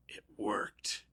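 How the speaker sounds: hushed, sneaky whisper; gleeful whisper. Retold or imitated. Scheming kid after a purchase, whispered. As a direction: whisper your secret win. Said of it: "they're going".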